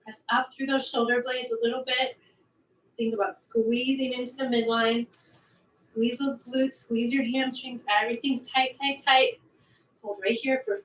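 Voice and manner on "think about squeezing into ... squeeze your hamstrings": usual speaking voice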